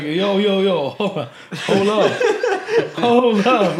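retold astonished outburst; stunned disbelief. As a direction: stop everything, loudly incredulous. Reenacts realizing who messaged him.

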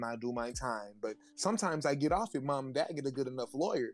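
usual speaking voice

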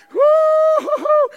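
high, loud, drawn-out woo-hoo-hoo; excited whoop. Acted. Soul excited to be leaving for heaven, joyful whoop. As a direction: whoop with joy at the top of the voice.

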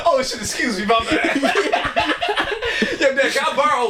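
laughing through a mock apology; giggling out an embarrassed sorry. Acting out walking in, laughing.

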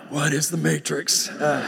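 a lightly voiced stunned Neo; a hint of a dumbfounded hero; mock dazed Neo. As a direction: say it like you're utterly lost, half laughing. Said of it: "But more like".

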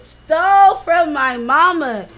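slow, loud, every word hit; outraged emphasis. Real, not acted. Friend tells podcast partner of the theft, outraged and slow. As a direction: spell it out slowly in outrage.

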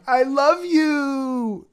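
very high, very slow and loud, stretching each word; eerie drawn-out I love you. Retold or imitated. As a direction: mimic the mother's haunting dragged-out reply. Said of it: "That's exactly how she said it".

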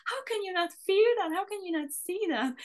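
can't believe they don't get it; child pleading with doubting classmates, incredulous. Retold or imitated. Child baffled friends feel nothing.

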